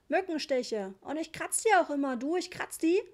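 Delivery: put-on high, loud, boastful child; child yelling. A narrator's reading. Richie in the dark announcing scratched bites, yelling. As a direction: pipe up loudly like an excited kid. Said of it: "schrie Richie irgendwo in der Dunkelheit".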